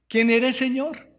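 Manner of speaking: high, loud awed question; awed cry. Acted. Paul fallen to the ground asks the Lord, crying out. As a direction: cry out in awe. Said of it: "él cae postrado".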